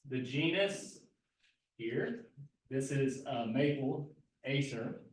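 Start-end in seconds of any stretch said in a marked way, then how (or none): none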